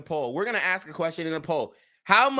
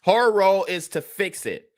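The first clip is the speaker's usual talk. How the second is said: ordinary delivery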